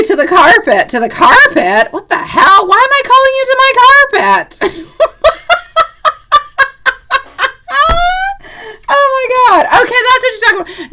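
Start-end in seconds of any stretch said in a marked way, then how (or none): 1.9-5.1 high, loud laughing disbelief. Real, not acted. Reader laughing at a misheard caller question, high and loud. 5.1-8.8 high, loud helpless laugh; a shrieking high laugh that runs long; high laughing fit. Real, not acted. Reader cracking up over a misheard word, a high loud laugh. 8.9-10.9 high, loud laughing disbelief. Real, not acted. Reader laughing at a misheard caller question, high and loud.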